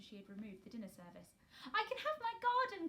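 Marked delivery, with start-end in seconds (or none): 1.7-2.9 much higher, louder joyful cry; cried out. A narrator's reading. Mary cries her news to Martha, high and joyful. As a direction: burst out with delight, high and loud. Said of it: "cried Mary".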